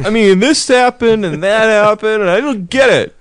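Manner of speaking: put-on high, loud cranky old man; ranting old man. Imitating ranting old reporter.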